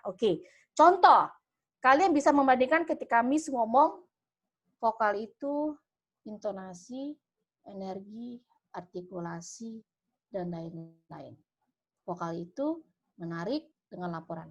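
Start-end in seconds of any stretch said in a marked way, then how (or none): 4.8-14.5 much softer and lower, lifeless; limp, listless delivery. Shown as a demonstration. Teacher showing students a weak voice, limp and much softer. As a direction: say it as if you have no energy at all. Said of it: "contoh yang kedua tadi loyo, lesu, tidak ada power".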